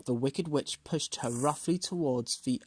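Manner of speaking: ordinary delivery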